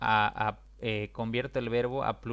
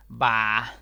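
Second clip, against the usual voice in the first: loud exclaimed "va"; interjection va; 8 dB louder than usual. Shown as a demonstration. Teacher demos "va" as a loud exclamation. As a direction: blurt out "va" as an exclamation. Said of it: "muchas veces usamos".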